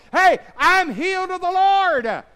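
loud, high shouted declaration; preaching at full force. Acted. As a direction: proclaim it triumphantly. Shouted confession of faith.

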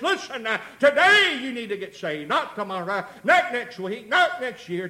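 loud, high-pitched pulpit exhortation; full-force preaching. Real, not acted. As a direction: drive each phrase home to the congregation.

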